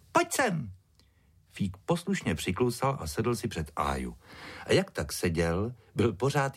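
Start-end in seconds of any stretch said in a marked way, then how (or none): 0.1-0.7 put-on high-pitched girl calling her dog; shouted command. A narrator's reading. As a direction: order the dog over in a high child voice. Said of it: "křikla na něj Ája".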